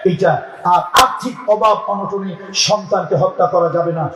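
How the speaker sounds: ordinary talk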